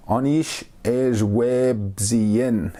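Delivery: very slow, word by word "Anish ej web zien"; slow demo. Shown as a demonstration. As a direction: spell the phrase out slowly for learners. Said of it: "you would say".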